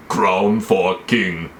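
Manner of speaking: very slow warlord voice; ponderous put-on brute voice; Khal Drogo. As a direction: stretch out each word like a warrior of few words. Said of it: "That's exactly what he sounds like".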